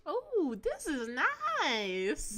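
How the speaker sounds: drawn-out, stuffy-nosed delivery; speaking right after a nasal spray. Real, not acted. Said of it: "I just sprayed roids up my nose".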